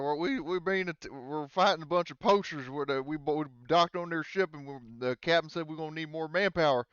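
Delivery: high, loud hillbilly pilot in a panic; Mike the hillbilly pilot. Acted. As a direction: yell it up high like a frantic country pilot. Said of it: "Mike's like".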